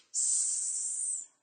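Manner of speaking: a long hissed "S"; isolated s sound. Shown as a demonstration. Teacher modeling a letter sound for a student, hissed. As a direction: hold the "S" as a pure hiss. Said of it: "say it as you do".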